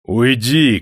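high, loud shout; shouted dismissal. A narrator's reading. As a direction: shout him out of the room. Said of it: "крикнул Никита".

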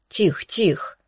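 soft, hushed soothing; calm him down gently. A narrator's reading. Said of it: "успокаивающим голосом проговорил".

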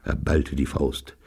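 usual speaking voice

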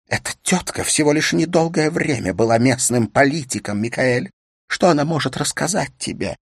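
scornful wife. Acted. Wife scorns the other woman.

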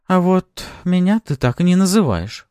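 high, sulky complaint; pouting. A narrator's reading. Pafi sulks at her master, pouting complaint. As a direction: complain through a pout. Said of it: "надула губки Пафи".

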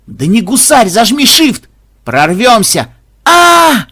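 loud, high urging yell; excited shout. Acted. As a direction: cheer him on, then scream. Gamer urging then crash.

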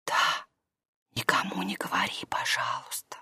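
very soft, low breath of a whisper; frightened whisper. A narrator's reading. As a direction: plead for secrecy in a whisper. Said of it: "Маша испугалась и ответила шёпотом".

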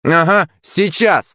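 high, drawn-out scornful retort; snapped retort. A narrator's reading. Team captain to gloating rival, scornful high retort. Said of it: "огрызнулся капитан команды".

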